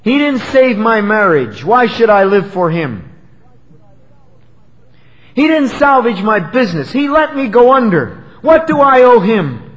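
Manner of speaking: loud, high-pitched indignant complaint; acted bitter grievance. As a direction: protest as a resentful believer would. Acted resentful believers blaming God.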